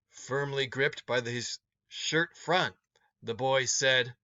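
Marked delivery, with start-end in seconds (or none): none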